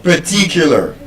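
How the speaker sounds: "particular" with weak first syllable and stressed TI; correct schwa pronunciation. Shown as a demonstration. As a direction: say "particular" with schwas either side of a strong second syllable. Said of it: "it's particular".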